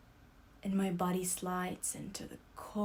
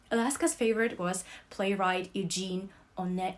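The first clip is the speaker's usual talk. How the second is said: ordinary delivery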